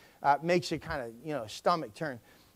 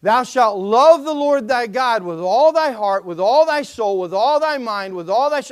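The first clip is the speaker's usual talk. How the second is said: solemn scripture reading; 2 semitones higher, 6 dB louder than usual. Acted. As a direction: intone each line, leaning on all. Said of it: "This all fits together".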